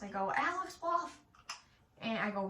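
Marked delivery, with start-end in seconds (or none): 0.4-1.2 calling out. Retold or imitated. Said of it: "I yell across the house".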